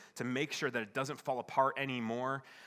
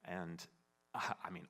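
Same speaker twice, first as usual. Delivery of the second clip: ordinary talk, nothing marked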